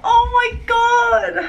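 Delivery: high delighted gasp and shriek; excited shriek. Real, not acted. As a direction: shriek with real surprise. Sees finished makeup, shrieks.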